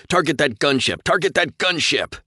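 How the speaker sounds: high, loud commanding shout; shouted order. A narrator's reading. Captain ordering his pilot to attack, shouting. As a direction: shout the order twice, hard. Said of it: "Nathan ordered".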